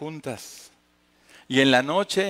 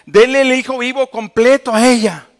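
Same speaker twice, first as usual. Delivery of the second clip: much higher, louder desperate no no no; exclaimed cry; 9 semitones higher, 11 dB louder than usual. Acted. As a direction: cry out to save the child. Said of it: "una de ellas exclamó". (la madre verdadera grita desesperada ante Salomón)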